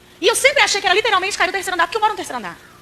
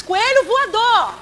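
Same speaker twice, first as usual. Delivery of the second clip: higher, stretched out slowly with a laugh; gleeful kid cry; 6 semitones higher, 3 dB louder, much slower than usual. Retold or imitated. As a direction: cheer like a delighted boy. Menino jogando o coelho. (grito alegre de criança)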